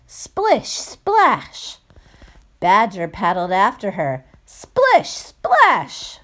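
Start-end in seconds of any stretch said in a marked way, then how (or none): 0.1-1.8 high, bouncy sound words; splashy sound effect. Acted. Sound effects read to children. 4.5-6.2 high, punchy sound words; splashy sound effect, louder. Acted. Sound effects read to children.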